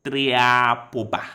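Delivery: TRIAPOBA sounded out slowly and loudly as English letters; read as English. Shown as a demonstration. Chess player guessing at a Russian word, read as English. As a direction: stretch Triapoba out letter by letter, TRI-AA-PO-BA. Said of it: "pronounce this in English".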